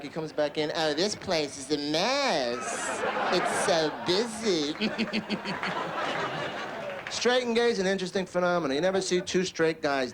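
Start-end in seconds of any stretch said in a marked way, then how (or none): 0.7-4.7 slow, drawn-out hammed-up fussing; camp lumberjack. 4.8-7.0 long, trailing put-on giggle; camp titter.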